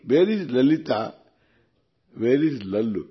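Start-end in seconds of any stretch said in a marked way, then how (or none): none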